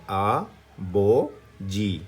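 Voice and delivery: आ बो जी drawn out very slowly; slow syllables. Shown as a demonstration. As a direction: stretch each syllable of बो जी. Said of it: "पहला आ है".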